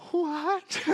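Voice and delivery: high, stunned retold disbelief; shocked what. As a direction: replay the shock of hearing bad news. Reacting to lost files news.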